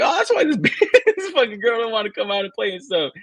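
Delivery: high-pitched mock outrage through laughter; laughing retold indignation. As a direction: act out the childhood grievance while laughing. Retold reaction, laughing while telling.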